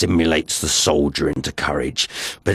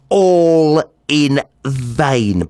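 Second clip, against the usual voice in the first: ALL in vain, slow and louder, falling; drawn-out emphasis; 7 semitones higher, 5 dB louder, much slower than usual. A narrator's reading. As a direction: stretch All in vain out slowly. Dramatic repetition of the phrase.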